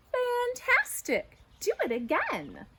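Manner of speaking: very high, slow "Fantastic" then eager urging; gushing praise. Acted. Princess egging the dragon on, high and gushing. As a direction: egg him on, overly thrilled.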